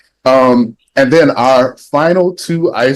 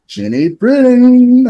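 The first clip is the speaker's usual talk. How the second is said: high, loud, stretched-out name; excited game-show style introduction; big guest announcement; 13 semitones higher, 6 dB louder, slower than usual. Real, not acted. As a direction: hype the guest's name like an emcee, drawing it out.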